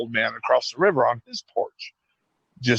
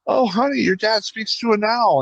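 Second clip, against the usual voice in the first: higher, sweet mom voice; imitating the mom; 10 semitones higher than usual. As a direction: play the mom, pitched well up. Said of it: "the mom's like".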